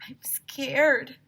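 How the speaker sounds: high, whispered fear; a scared girl whispering. Acted. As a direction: breathe it out, frightened. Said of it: "said Emily as we lurked".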